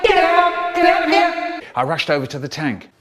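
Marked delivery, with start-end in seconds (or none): 0.0-1.3 shouting out. Retold or imitated. Boy yells at brother to escape the venom, high loud shout. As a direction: scream at your brother to flee. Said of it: "I shouted out".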